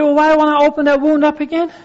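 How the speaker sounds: high, loud, fast protesting griever; voicing the griever. Griever asking why reopen the wound, high protest. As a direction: play the reluctant griever pushing back. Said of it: "You say".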